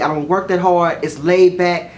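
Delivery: ordinary talk, nothing marked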